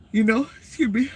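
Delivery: words stretched through a yawn; sleepy yawn breaking the words. Real, not acted. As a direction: talk through a big yawn, pitch up. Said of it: "just talking about sleeping, that happened".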